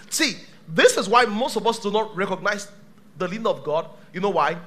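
ordinary delivery

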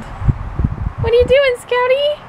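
high, slow cooing voice. Real, not acted. Cooing to baby.

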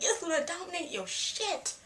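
high-pitched, emphatic fangirl urging; mock fierce cheerleading. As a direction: egg her on, pitched well up. Cheers Luna on in mock dialogue.

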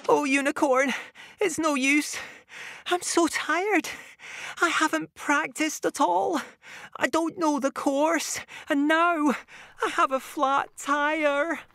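high, weary defeated voice; tired ninja robot. Acted. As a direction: sound worn out and beaten. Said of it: "panting heavily".

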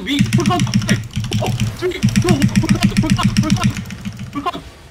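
shouted full count; loud countdown call. Real, not acted. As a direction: yell the countdown to the team. Shot-caller counting down the engage.

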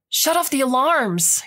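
urgent yell across chaos. Acted. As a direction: shout over the alarms. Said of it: "Quinn yelled".

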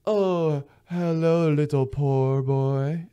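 high, drawn-out condescending greeting; mock snootiness. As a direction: play someone lording it over a pauper. Acted snooty self after fine meal.

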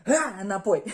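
loud burst, snapping back; sudden loud shout. Acted. Acting out the moment of snapping back, loud sudden shout. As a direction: explode on it suddenly.

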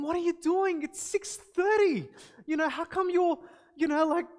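high, loud, baffled parent asking what's going on; startled parent. Acted. Parent finding daughter cleaning at dawn, startled and baffled. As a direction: sound caught off guard at dawn.

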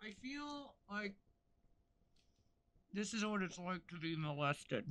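muffled, mouth covered by mask. Real, not acted. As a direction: talk through a covered mouth. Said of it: "'Cause my mouth is covered".

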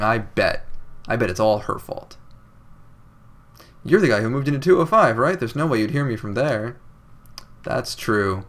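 normal talking voice

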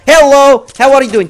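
high, loud frantic greeting; worked-up, alarmed holler; startled shout. Real, not acted. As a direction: yell at an enemy who pops up. Startled by enemy appearing.

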